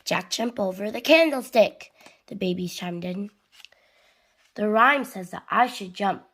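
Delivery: lively, projected picture-book reading; children's story narration. A narrator's reading. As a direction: read it animatedly to little kids. Reads storybook aloud to children.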